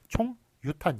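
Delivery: 총 유탄 with a break after 총; chong, yutan, kept apart in 총 유탄; split reading. Shown as a demonstration. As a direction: say 총, then 유탄 as its own word. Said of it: "이렇게 읽기 때문에".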